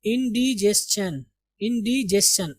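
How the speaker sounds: इंडिजेशन with DI as dee, ending chan; correct pronunciation. Shown as a demonstration. Teacher repeating it for students, in-dee-jes-chan. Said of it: "इसको आप इनडाइजेशन नहीं बोलेंगे".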